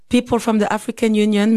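ordinary delivery

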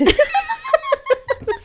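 high helpless burst of laughter; cracking up at the husband's retort; big laugh. Real, not acted. Wife retelling husband's joke, laughing hard.

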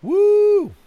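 high, loud drawn-out whoop; imitated crowd woo. Mimicking fans at a show, high crowd whoop. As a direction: whoop like someone in the audience. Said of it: "But just like".

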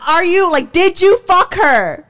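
insistent retold yelling; heated demand. Acting out escalating interrogation.

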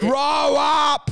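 shouted, high and loud; mock yelled scold. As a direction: bark it out like a scolding. Repeats retort as a shout.

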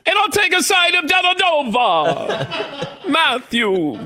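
high, slow, laughing menu announcement; mock Italian dish name. Host names the player as a pasta dish, laughing. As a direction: present it like a pasta special. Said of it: "go into a restaurant and say".

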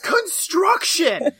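high, loud, drawn-out single word; exasperated mock cry. Prisoner pointing at the gallows being built, mock cry. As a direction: belt it out high, stretching the syllables. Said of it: "He's like".

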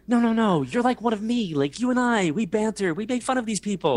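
high, urgent mock appeal; retold incredulous appeal. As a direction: plead in a high voice, acting out disbelief. Imploring his cousin in the story.

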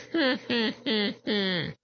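high drawn-out witch cackle. Acted. Witch laughs after threat.

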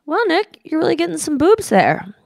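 a goofy high take on her clueless dad; imitating dad. Imitating her dad commenting at lunch, high and goofy. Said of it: "And my dad said".

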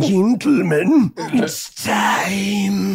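high, slow announcer voice; Quizster voice. Retold or imitated. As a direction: announce it grandly like a game host. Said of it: "He's like".

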